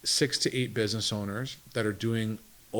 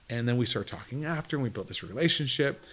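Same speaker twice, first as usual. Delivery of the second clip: ordinary delivery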